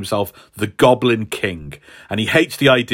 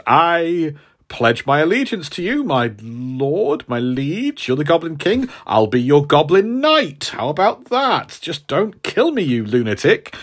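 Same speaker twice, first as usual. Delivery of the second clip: higher-pitched, hammed-up pleading underling; mock-servile, pitched up and wheedling; grovelling pledge; 4 semitones higher than usual. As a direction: plead for your life while swearing loyalty. Acted pledge to a feared villain.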